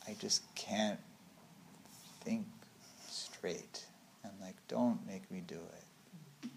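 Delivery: soft, halting, drained of energy; acted torpor. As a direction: trail through it with long foggy pauses. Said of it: "torpor is a little bit more mind".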